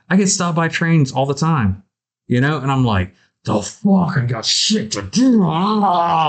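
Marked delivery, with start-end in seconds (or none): 3.5-6.3 acted exasperated outburst, raised pitch; mock fury at being held up; pissed off. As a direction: rant and end in a drawn-out growl of frustration. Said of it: "like, just pissed off".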